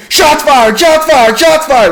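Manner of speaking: high, loud panicked radio yell; freaking out. Retold or imitated. Ranking officer on the radio alerting everyone, freaked-out shouting. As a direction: yell the alarm, pitch way up. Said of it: "freaking the fuck out".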